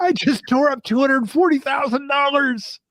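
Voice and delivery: high whiny mocking voice; hammed-up lament; mocking the graduate who tore up her diploma; mock regretful graduate. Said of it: "mocking the graduate's regret".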